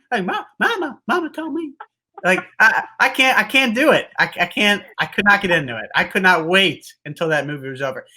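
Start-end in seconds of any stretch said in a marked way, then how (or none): 0.2-1.7 put-on lampoon the Waterboy's Cajun accent, voice pushed way up; imitating Waterboy accent. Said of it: "the stupid accent".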